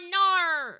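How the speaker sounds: ordinary talk, nothing marked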